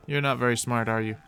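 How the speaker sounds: ordinary talk, nothing marked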